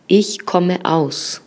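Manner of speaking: Ich komme aus again very slowly, stress on Ich; teacher repeating Ich komme aus. Shown as a demonstration. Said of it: "vamos a decir yo vengo de".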